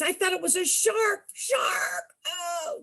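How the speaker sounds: high, crying-out reliving of the scare; high alarmed cry of shark panic; retold fright. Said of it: "I got all upset".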